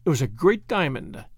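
plain reading voice

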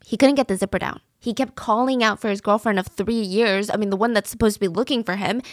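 normal talking voice

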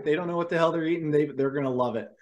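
ordinary talk

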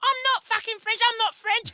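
put-on high, whiny child protesting; a bullied boy yelling back in a squeaky voice; furious kid. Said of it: "I reckon he was like".

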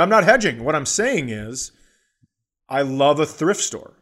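usual speaking voice

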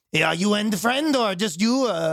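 high, slow voice, a little put-on; mock Quebec stripper. Imitating the stripper asking about the friend, high and slow. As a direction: ask it like the club lady sizing up customers.

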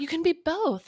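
sing-song mockery of a patronising remark; mocking. Mocking Harry's quote.

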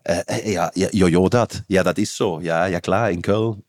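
low-pitched Cologne dialect demo; Kölsch. Shown as a demonstration. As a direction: slip into a rough approximation of Kölsch. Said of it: "so ist ungefähr Kölsch". (tiefes Kölsch, ungefähr nachgemacht)

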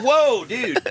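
high, laughing whoa. Retold or imitated. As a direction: blurt it out, amazed.

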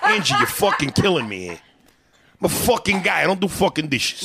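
loud, indignant New York Italian husband shouting. Pretend. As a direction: rant like a macho Italian guy asked to do dishes. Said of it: "do you do an Italian lady".

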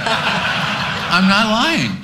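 drawn out slowly through real laughter; laughing protest. Real, not acted. Insisting while laughing.

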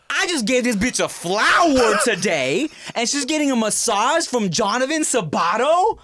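high, slow, outraged disbelief; indignant jealous outburst. Retold or imitated. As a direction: play the betrayed preschooler, half laughing. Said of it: "being like".

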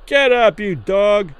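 high, loud heckle; angry yell. A narrator's reading. Man behind the ropes to the seated fighter, yelling. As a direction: yell it at him like a hostile spectator. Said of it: "some man yelled through the ropes".

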